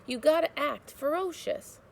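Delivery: put-on old woman urging.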